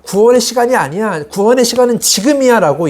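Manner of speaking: lightly voiced leader exhorting the people; retold exhortation, rising in pitch; Moses. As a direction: urge them on, pitch climbing high. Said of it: "다시 얘기하면 모세는".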